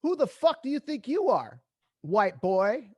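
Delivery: high, loud mock-menacing challenge; angry street tough. A big man on the sidewalk yelling at the speeding driver, loud and menacing. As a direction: bark it like a big guy squaring up. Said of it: "he says".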